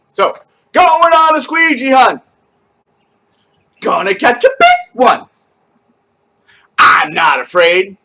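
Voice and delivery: high loud wild chanting. Shown as a demonstration. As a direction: shout it out like a crazy camp leader. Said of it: "a little bit louder, a little bit more crazy".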